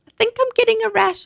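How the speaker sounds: put-on high, fretful child's voice; a small girl sure she's itching; whining little girl. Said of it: "I said".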